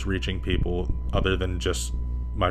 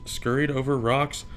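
ordinary delivery, nothing marked

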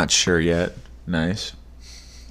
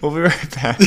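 laughing; 9 semitones higher than usual. Real, not acted. Still laughing after crash.

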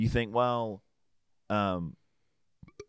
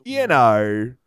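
mock Russian parent; 5 semitones higher, 8 dB louder, much slower than usual. Parents voiced in retold story.

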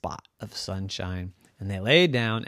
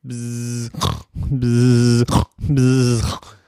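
buzzing snore, louder at the end; snoring bee; 7 dB louder, much slower than usual. Acted. Bee snoring on the cat's head, buzzing snore. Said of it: "the bee started to snore".

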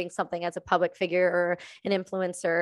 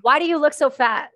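blunt stranger; 5 semitones higher, 5 dB louder than usual. Retold or imitated. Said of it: "where they go".

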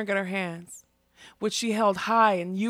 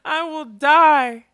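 slow, much louder and higher wail; anguished wail; 5 semitones higher, 11 dB louder, much slower than usual. A narrator's reading. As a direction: drag each word out in despair. Girl tearing at her new body.

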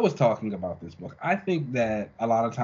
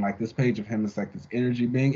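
usual speaking voice

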